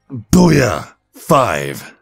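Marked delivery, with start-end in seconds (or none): 0.1-0.9 high, punchy booyah; triumphant cheer. Acted. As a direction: celebrate the final rep. Celebrates the final rep.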